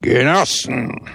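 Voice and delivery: booming cry like the wrath of God; wrathful call. Acted. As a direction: thunder the word at the comrades. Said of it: "die jener des Zornes Gottes glich".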